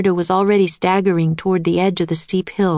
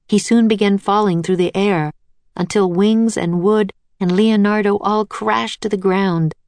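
ordinary delivery, nothing marked

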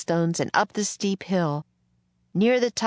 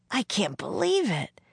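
close to tears; 4 semitones higher than usual. A narrator's reading. Jack watches the wagon leave, close to tears. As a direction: say it upset, on the edge of tears. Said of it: "He was close to tears".

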